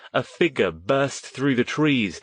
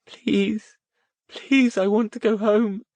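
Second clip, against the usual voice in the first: pleading moan; 13 semitones higher, 4 dB louder, slower than usual. A narrator's reading. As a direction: beg through tears to go home. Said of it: "Rosemary moaned".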